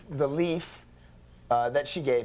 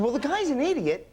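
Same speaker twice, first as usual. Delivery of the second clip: high, louder snap; high irritated retort; 11 semitones higher, 3 dB louder than usual. Retold or imitated. Husband snaps back at wife, high and annoyed. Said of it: "And I said".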